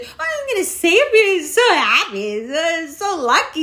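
sarcastic imitation of a gullible twenty-something; mocking naive voice. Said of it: "you bitches ... who think that".

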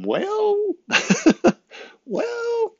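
sudden high falsetto with a laugh; mock squeaky voice. Vlogger mocking his own acting theory in a high squeak.

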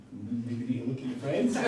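breaking into laughter at the end; amused, laugh taking over. Real, not acted. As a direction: say it through a rising laugh. Joking about son's friends, laughing.

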